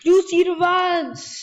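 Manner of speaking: high, loud gloating cry; loud victorious revenge yell; triumphant shout. Real, not acted. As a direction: shout it as you strike back. Taking revenge on opponent.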